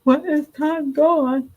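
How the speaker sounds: high, drawn-out pretend lament; mock crying. Mom mocking parents who mourn passing time, pretend crying. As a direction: wail it slowly, as if tearful. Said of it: "I don't need to cry".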